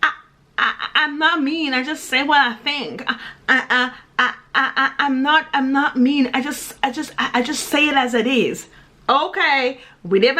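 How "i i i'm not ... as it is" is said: imitated judgmental people. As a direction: stumble over each start like a defensive excuse-maker. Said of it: "People who say".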